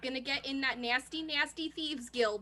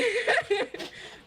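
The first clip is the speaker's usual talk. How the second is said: high laugh; 10 semitones higher, 6 dB louder than usual. Real, not acted. GM laughs at own voice.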